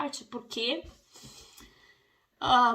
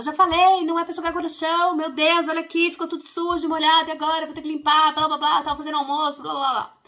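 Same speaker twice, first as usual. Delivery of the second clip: much higher, much louder scolding; angry yelling; 6 semitones higher, 9 dB louder than usual. Retold or imitated. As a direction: scold like a fed-up mom. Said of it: "você pode gritar, ficar brava".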